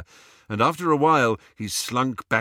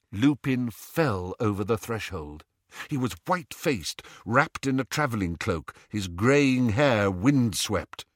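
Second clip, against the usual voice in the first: plain reading voice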